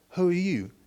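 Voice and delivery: quick Southern-accented impression of the man who startled her; imitating Craig's Southern accent. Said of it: "I was how he talks".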